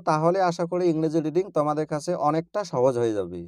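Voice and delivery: ordinary talk, nothing marked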